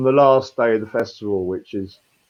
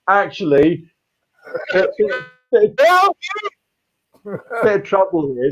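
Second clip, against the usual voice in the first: mock worried call from sea; 10 semitones higher, 4 dB louder than usual. Calling from water to shore crowd.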